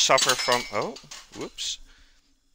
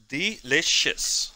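slow, stretched-out and higher-pitched; savoring, drawn-out; 5 semitones higher, much slower than usual. Real, not acted. Cook praising the stock to viewers, slow and savoring. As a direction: savor the word, drawing out each syllable. Raving about the tasted stock.

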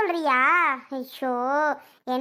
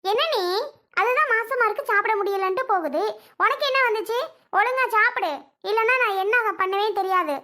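high-pitched furious scolding of her brother; angry scolding; 5 semitones higher than usual. Acted. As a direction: tear into him, pitch up and sharp. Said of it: "எனக்கு கோவம் கோவமா வருது".

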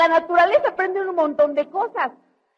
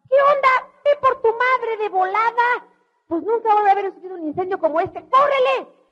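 thrilled father; 8 semitones higher than usual. Retold or imitated. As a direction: sell it like the best show on earth. Father excited amid fire.

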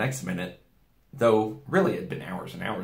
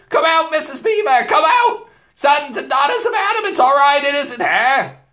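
very high, much louder calling out; excited shouting beaver; 10 dB louder than usual. A narrator's reading. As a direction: yell for everyone to come out. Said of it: "he shouted".

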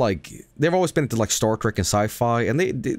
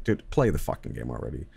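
low, softer grumble; impatient viewer; 11 semitones lower, 6 dB quieter than usual. Retold or imitated. Viewer fed up with the streamer's story, grumbling impatience. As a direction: drop low and grumble it as an aside.

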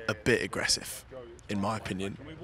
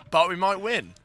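louder and higher, excited; 8 semitones higher, 8 dB louder than usual. Real, not acted. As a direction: burst out with sudden hope. Excited outburst.

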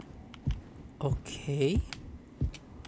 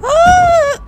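sudden high piercing scream; startled scream; 13 dB louder than usual. Real, not acted. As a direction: scream as the floor drops away. Falling down in the game.

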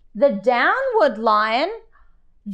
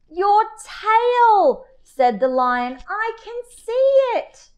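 lion teacher; 10 semitones higher than usual. Acted.

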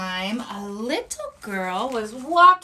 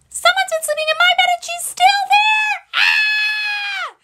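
very high, loud, fast squeal ending in a cry; alarmed Baby Bear; 6 dB louder than usual. Acted. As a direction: shriek it out like a startled little bear. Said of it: "And Baby Bear said".

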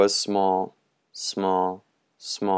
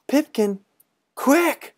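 calling out; 3 dB louder, slower than usual. Acted. Boys calling to Pipkin.

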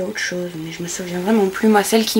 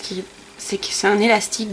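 ordinary delivery

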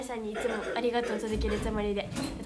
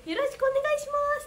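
imitated loud greeting; 12 semitones higher, 4 dB louder, much faster than usual. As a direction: imitate a chorus greeting the stage. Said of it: "大きい声で言ってます". (大きな声の挨拶を高い声で再現)

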